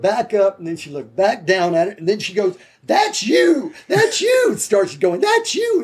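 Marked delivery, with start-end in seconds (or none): 2.8-4.5 imitating an excited woman's very high shout; high, squealed mimicry of thrilled recognition. Checkout lady recognizing the cover star in front of her, excited. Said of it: "she was jumping up and down". 5.2-5.8 imitating an excited woman's very high shout; high, squealed mimicry of thrilled recognition. Checkout lady recognizing the cover star in front of her, excited. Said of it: "she was jumping up and down".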